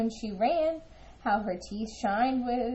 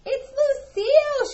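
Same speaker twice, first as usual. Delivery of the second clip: high, loud delighted cry; shouting the pet's name with glee; excited shout; 6 dB louder than usual. Acted. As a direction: call out the name with joy. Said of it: "shouted Ava, laughing".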